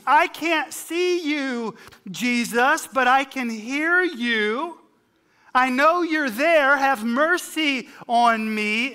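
high, loud pleading cry for mercy; blind man's scream. Retold or imitated. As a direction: cry out to be heard over the crowd. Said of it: "A blind man screams".

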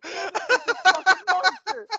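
high, loud, slow words through laughter; real laughter breaking through the story; laughing. Real, not acted. Laughing hard while telling.

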